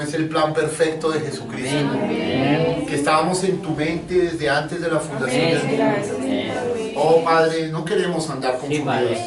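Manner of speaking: hushed pastor's prayer over the congregation; soft prayer. Acted. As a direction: pray quietly to the Lord, drawing words out. Pastor leads closing prayer.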